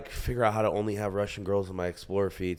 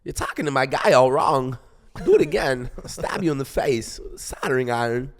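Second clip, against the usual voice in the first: louder, higher celebrity impression with odd tune; Christopher Walken; 4 semitones higher, 5 dB louder than usual. Showing off a party impression, Walken voice. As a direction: punch it out like an offbeat movie menace. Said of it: "Everyone loves my Christopher Walken accent".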